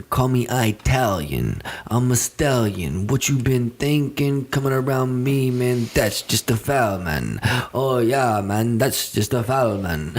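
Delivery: put-on slow, lazy Italian accent rap. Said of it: "I'm gonna rap in Italian".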